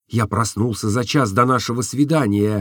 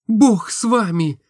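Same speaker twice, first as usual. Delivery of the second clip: put-on higher, lighter girlish voice for Nastenka; Nastenka's voice; 11 semitones higher than usual. A narrator's reading. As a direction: voice the young woman, tender and open. (голос Настеньки, выше и легче)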